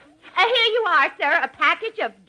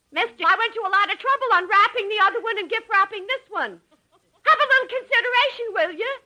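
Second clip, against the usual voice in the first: higher, fast, fed-up rant; the clerk scolding a fussy customer, fast and fed up; exasperated scolding; 10 semitones higher, faster than usual. Acted. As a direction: let your patience snap and scold him.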